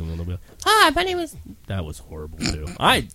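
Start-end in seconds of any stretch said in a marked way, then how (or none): 0.6-1.4 high falsetto lady voice; fake lady voice. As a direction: squeak it like a woman. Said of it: "fake ladies' voices".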